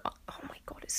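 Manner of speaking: hushed embarrassed whisper. Retold or imitated. Woman alone in her room, mortified whisper. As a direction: whisper it as if someone could hear. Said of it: "Oh my God, what if I look".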